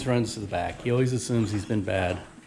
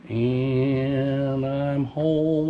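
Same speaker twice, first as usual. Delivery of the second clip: drawn out; 2 semitones higher, much slower than usual. Real, not acted. Arriving home drawn out.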